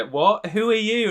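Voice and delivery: put-on little boy. Boy asking the stranger who he is, high child voice. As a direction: pitch it up like a puzzled cartoon boy. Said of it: "The, the little boy goes".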